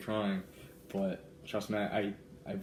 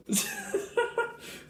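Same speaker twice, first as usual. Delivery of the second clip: helpless high giggling; high-pitched laughing fit. Real, not acted. Vlogger reaching the booklet's next page, high squealing laugh.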